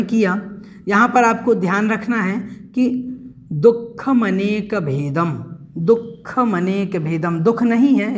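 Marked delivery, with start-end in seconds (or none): 3.5-7.4 दुःखमनेक said slowly with the visarga breath, dukh-ma-nek; careful pronunciation. Shown as a demonstration. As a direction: stretch दुःखमनेक out, dukhamanek. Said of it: "इसको दुख बोलना है हमें". (दुःखमनेक को धीरे, साफ़ उच्चारण)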